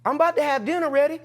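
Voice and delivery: high, chirpy motherly chatter; high-pitched motherly impression; mom's voice. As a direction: imitate a mom greeting her boy. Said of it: "she said, she said".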